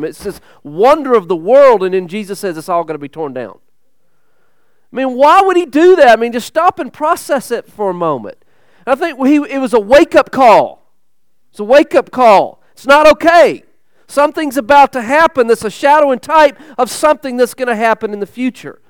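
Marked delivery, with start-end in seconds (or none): 4.9-6.2 loud, high urgent preaching; a preacher sounding the alarm; preaching at full force. Acted. 8.8-16.2 loud, high urgent preaching; a preacher sounding the alarm; preaching at full force. Acted.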